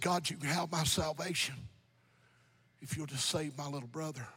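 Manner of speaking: low, hushed plea fading very soft; subdued, tearful retold prayer. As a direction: softly imitate a crying man praying. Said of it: "He's crying".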